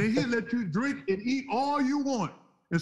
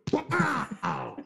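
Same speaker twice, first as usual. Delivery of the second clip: imitated punch sound; 4 semitones higher than usual. Mimics the hit on teller.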